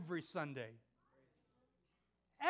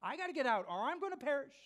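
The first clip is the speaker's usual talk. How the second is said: panicked clown; 4 semitones higher, faster than usual. Retold or imitated. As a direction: blurt it out as if fleeing. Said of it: "finally the clown said".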